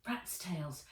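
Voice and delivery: ordinary delivery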